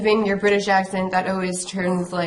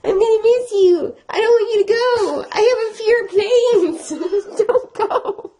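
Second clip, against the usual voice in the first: high, loud wailing plea; hammed-up tearful whine; mock bawling; 13 semitones higher, 4 dB louder than usual. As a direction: wail it up high, half laughing. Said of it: "like, bawling my eyes out".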